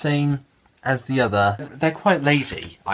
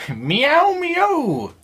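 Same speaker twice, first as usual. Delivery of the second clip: very high, loud playful meow; cat meow; 4 dB louder than usual. Acted. Vlogger cheers for the story cats with a very high meow. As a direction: mew like an excited kitten. Favourite characters are the cats.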